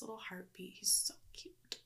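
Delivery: tender whisper. Real, not acted. Cooing about baby.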